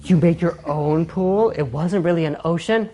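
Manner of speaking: slow, hushed gushing fan; mock fascinated fan. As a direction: play an overawed fan. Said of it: "people are like".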